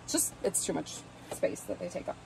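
ordinary talk, nothing marked

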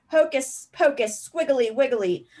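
a hammed-up fierce spell to scare a cousin; fierce mock spell. A narrator's reading. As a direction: intone it like a menacing wizard. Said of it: "said Harry in a fierce voice".

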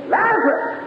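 high, ringing preacher's summons; commanding call. Acted. Jesus calling Lazarus from the grave, commanding call. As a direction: call the dead man out of the grave. Jesus calls the dead man out.

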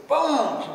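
high, booming slam sound; imitated slam. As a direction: imitate a receiver crashing down. Imitating phone slammed on receiver.

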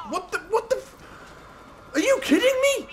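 very high incredulous squeal; outraged disbelief. Real, not acted. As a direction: voice shooting up in disbelief. Car suddenly wrecked in game.

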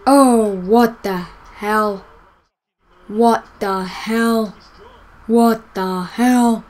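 slow, drawn-out baffled what the hell. Real, not acted. Gamer baffled by a weird in-game moment, slowly. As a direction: stretch out the disbelief.

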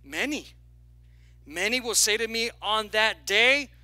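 ordinary talk

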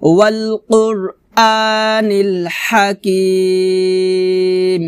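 slow chant with a long-held final "kim"; Quran recitation. Shown as a demonstration. Teacher showing learners the correct way, slow tajweed recitation. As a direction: recite slowly, stretching the last syllable. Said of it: "এভাবে".

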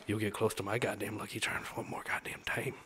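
put-on whispered threat, Irish accent; menacing Irish whisper. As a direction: hiss a threat in an Irish brogue. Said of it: "that's my Irishman".

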